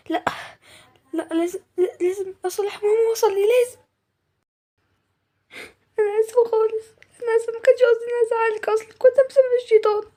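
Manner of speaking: high-pitched, pleading remorse; tearful apology. Acted. Daughter apologises to her mother, high tearful pleading. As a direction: plead for forgiveness on the edge of tears.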